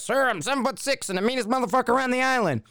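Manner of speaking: high, strained military reply; mock recruit sounding off. Recruit answering the officer as coached, high mock sounding-off.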